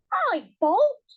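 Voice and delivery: the wife scornfully retorts to husband. A narrator's reading. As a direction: throw the blame back at him. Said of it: "retorted his wife scornfully".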